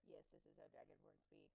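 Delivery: monotone robot voice; flat robotic monotone. Pretend. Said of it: "use the robot... monotone voice".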